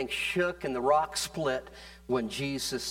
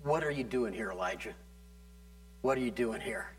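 soft voice of God; 4 semitones lower, 6 dB quieter than usual. Acted. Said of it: "a voice came to him".

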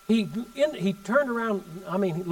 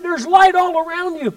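awestruck cry; 9 semitones higher, 6 dB louder than usual. Retold or imitated. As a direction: cry it out like a man seeing a vision. Man freaking out over light.

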